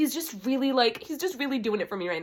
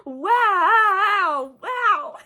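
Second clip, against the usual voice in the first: high, loud, drawn-out wail; overwhelmed wow; 7 semitones higher, 6 dB louder than usual. Real, not acted. Reader awestruck by her book, high wailed wow.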